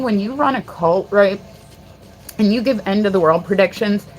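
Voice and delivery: ordinary talk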